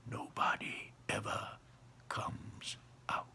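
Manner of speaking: hushed secret whisper; soft whisper. A narrator's reading. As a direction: lean in and share a secret. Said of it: "lowered his voice to a soft whisper".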